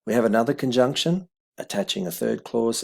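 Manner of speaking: ordinary talk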